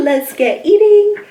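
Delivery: high, loud, eager call; excited. Real, not acted. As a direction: cheer them into the meal. Excited start of eating.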